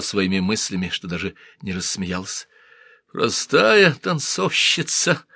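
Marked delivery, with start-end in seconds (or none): 3.1-5.2 muttered scorn. Acted. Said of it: "пробормотала она".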